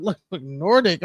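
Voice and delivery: laughing, Nordic said like a joke; "Nordic" with an incredulous laugh; laughing disbelief. Real, not acted. Showing viewers his DNA result, laughing in disbelief. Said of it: "Am I an alien?".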